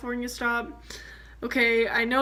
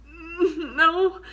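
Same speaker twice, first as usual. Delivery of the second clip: tearful; 4 semitones higher than usual. Retold or imitated. Admitting no license, near tears.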